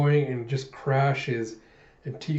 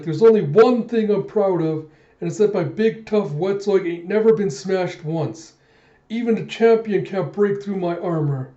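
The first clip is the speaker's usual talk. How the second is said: raised, loud, emphatic fighter's bragging; proud boast; 6 semitones higher, 5 dB louder than usual. Retold or imitated. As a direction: boast defiantly in the middle of a fight. Acting Teagle's proud boast.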